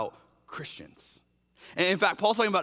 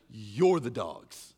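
low accusation; 8 semitones lower, much slower than usual. Retold or imitated. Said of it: "Paul's going".

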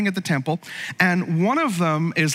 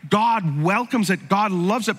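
normal talking voice

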